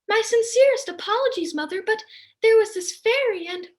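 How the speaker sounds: put-on a chirpy goody-goody girl; sweet daughter. Angelica apologizes to her mother, high and prim.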